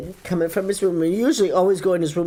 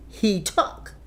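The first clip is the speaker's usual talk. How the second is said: ordinary talk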